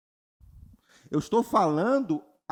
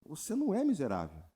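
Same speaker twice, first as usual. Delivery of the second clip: ordinary delivery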